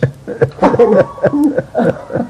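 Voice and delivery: loud, high laugh. Real, not acted. Laughing after telling the funny dance story, real laugh. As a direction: burst out laughing at your own punchline.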